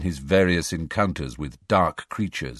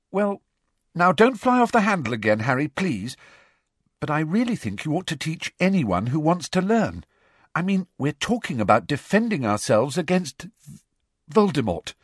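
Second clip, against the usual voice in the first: anxious; 9 semitones higher than usual. A narrator's reading. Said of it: "looking a mite anxious again".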